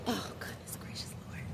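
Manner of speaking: breathless whisper; exasperated whispered exclamation; whispered aside. Real, not acted. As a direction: drop to a hush.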